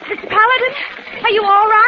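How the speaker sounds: high, loud worried cry; anxious call. Acted. Doctor calls to Paladin after the shootout, anxious. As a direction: call out to him in fear.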